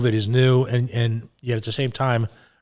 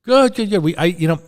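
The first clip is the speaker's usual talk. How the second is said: excited; 7 semitones higher, 6 dB louder than usual. Real, not acted. Greets caller.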